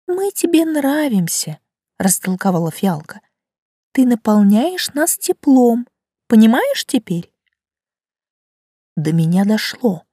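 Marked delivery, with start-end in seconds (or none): 0.1-1.6 slow, high flower voice; gentle talking flower, slow; violet voice. Acted. Violet explains. 3.9-7.3 slow, higher flower voice; patient talking flower; violet voice. Acted. Violet explains.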